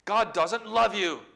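lightly acted jeer, stretched out; crying out. The enemy trying to discourage you, crying out. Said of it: "he cries".

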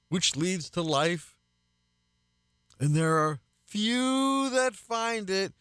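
high, slow, lamenting cry of the verse; dramatic exclamation. Acted. Pastor voicing Jesus's emphatic cry to his listeners, high and dramatic. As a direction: voice Jesus crying out over the narrow gate, drawn out. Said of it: "he's being very dramatic when he says".

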